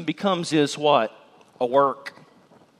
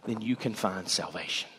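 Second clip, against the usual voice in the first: much softer and lower; hushed assurance; 9 semitones lower, 9 dB quieter than usual. Real, not acted. Preacher assuring hearers salvation has found them, soft and low. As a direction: let it settle quietly. Closing promise.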